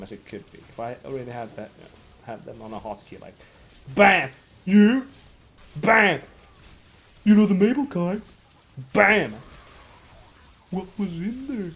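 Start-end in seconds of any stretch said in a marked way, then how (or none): 3.9-11.7 loud punchy sound-effect yells; soundboard shouts. Pretend. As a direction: blast each clip out loud. Acting out soundboard clips.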